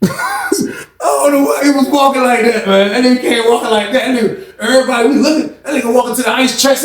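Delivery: high, cracked-up squeal of amusement; laughing while talking. Real, not acted. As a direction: barely get the words out through laughter. Cracks up at his own imitation.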